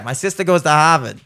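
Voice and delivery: amateur Boston accent, dropped R in Harvard; attempted Boston accent. Shown as a demonstration. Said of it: "I can do that".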